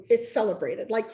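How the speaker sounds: usual speaking voice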